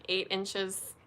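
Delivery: ordinary talk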